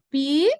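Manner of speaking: "pi" held alone with a clear i; sounded-out syllable. Shown as a demonstration. Teacher voicing a student's mistaken split, isolated drawn-out pi. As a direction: stretch "pi" out as its own syllable. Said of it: "do jeito que eu falo seria assim".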